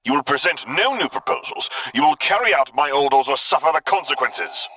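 imperious dark lord ultimatum; Vader. A narrator's reading. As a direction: lay down the law. Vader voice held by narrator.

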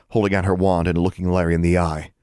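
ordinary delivery

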